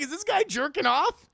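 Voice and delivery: high, loud, amused disbelief; mock incredulous question. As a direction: imitate an eavesdropper who can't believe it. Voicing amused eavesdropper.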